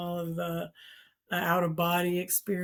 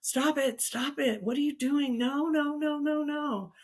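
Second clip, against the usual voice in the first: high, insistent voice, barely louder; mock screaming; 8 semitones higher than usual. Loud voices on the phone telling her to stop, mock screaming. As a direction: imitate panicked voices without really screaming. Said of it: "loud voices just screaming".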